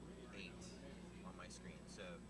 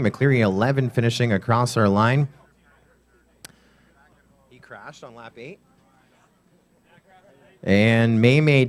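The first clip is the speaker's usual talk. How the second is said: brisk live race commentator; play-by-play commentary; 33 dB louder, slower than usual. Acted. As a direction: call the race live, keep momentum.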